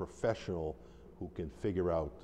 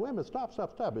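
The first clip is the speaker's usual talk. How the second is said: much higher, urgent cry of stop; urgent shouted stop; 14 semitones higher, faster than usual. Retold or imitated. Minister halting a shouting match with the spirit, urgent high cry. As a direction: cut the chaos off with a sudden high call. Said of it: "and I said".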